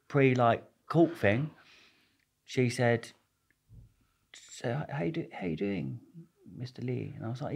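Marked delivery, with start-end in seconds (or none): 4.3-7.1 softly imitated posh woman, nearly whispered; posh lawyer. Lawyer greeting her client, softly imitated posh woman. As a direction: quote her in a very soft voice. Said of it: "some posh young woman".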